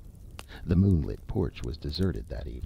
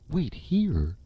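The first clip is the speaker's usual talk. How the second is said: sharp, much higher indignant echo; outraged cry; 10 semitones higher than usual. Acted. Young woman flings back his words in hurt outrage. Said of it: "she cried".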